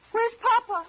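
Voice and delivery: high, loud frightened question; suddenly panicked, demanding an answer; alarmed demand. Acted. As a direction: daughter fearing for her father. Daughter fears father is lost.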